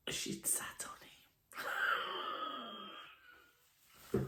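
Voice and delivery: hushed, breathless punchline; whispered reveal. Real, not acted. Telling the viewers the budgie's fate, whispered. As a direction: whisper it like a guilty secret.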